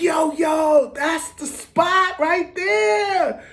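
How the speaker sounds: high, drawn-out excited call; excited shout. Retold or imitated. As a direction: call it out slowly, thrilled. Quoting his own excitement at the bakery.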